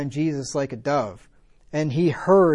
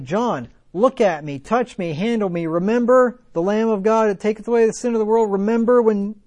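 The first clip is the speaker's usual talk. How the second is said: higher and louder, building to an urgent plea; rising pleading cry, louder with each remember; imagined Jesus pleading; 6 semitones higher, 5 dB louder than usual. Pretend. Preacher voicing Jesus pleading with John in prison, building loud. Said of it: "and be like".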